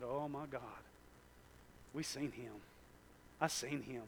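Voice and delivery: soft, low, awestruck; awed hush. Acted. Peter softly awed at seeing God. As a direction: barely breathe it in wonder.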